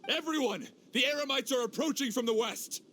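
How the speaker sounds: high, urgent call to the troops; rally everyone for a fight; urgent military call, pitched high; raising the alarm. Acted. Soldiers raise alarm before battle.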